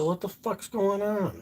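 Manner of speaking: high and slow, bewildered; high bewildered question; retold alarm. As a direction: stretch it out in disbelief. Retold confusion to mom.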